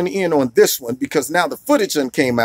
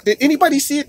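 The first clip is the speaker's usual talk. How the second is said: a higher, questioning teacher's voice; 9 semitones higher, faster than usual. Retold or imitated. Teacher asks the class, voice raised higher. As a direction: lift it up like a teacher asking the class. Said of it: "she was like".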